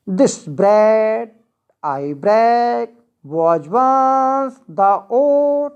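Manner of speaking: slow scansion, stressing "bread", "break", "once", "oat"; iambic stress. Shown as a demonstration. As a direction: stretch each stressed word, like "bread" and "oat", over a light first beat. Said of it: "पहला unstress, दूसरा stress". ("bread", "break", "oat" को खींचकर, धीमी iambic लय में)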